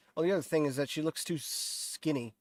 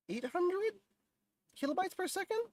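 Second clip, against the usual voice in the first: incredulous; 7 dB quieter than usual. Real, not acted. Doubting YouTube's bitrate advice.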